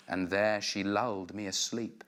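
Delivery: ordinary talk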